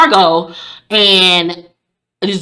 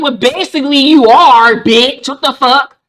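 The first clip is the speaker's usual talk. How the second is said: higher, louder indignant yell; outraged retort; 6 semitones higher, 5 dB louder than usual. Retold or imitated. Viewer at Steven, louder higher outraged yell. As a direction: yell it at the screen. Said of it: "I was like".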